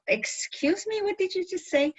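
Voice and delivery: lightly voiced incredulous stranger; mock confused listener. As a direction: imitate a baffled local asking her to repeat. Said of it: "that look of".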